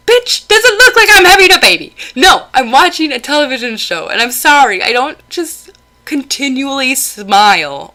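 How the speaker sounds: snapping back at the relative over being watched, loud and laughing; exasperated retort. Pretend. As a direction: blow up at him, half laughing. Imagined retort to the nosy relative.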